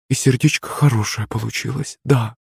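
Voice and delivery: put-on soft, tender drunk; fond drunken murmur. A narrator's reading. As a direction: murmur affectionately to your sweetheart. Drunk murmurs fondly to sweetheart. (нежное пьяное бормотание)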